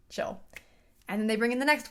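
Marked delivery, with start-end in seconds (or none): 0.1-0.4 soft, low-key calm imitation; a quiet, unbothered one-word reply; level-headed calm. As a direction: play the calm detective, softly. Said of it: "he's very level-headed".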